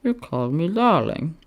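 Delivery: sleepy Roman teasing his friend. A narrator's reading. Roman Octavius voiced, teasing.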